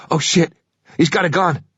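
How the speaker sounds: sudden frightened yell; panicked cry. Acted. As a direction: voice jumps up in fear. Passenger panics at gun.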